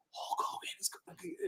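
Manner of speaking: frantic whisper. Retold or imitated. To his girlfriend during the call, frantic whisper. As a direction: whisper it so the phone won't hear. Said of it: "I'm like".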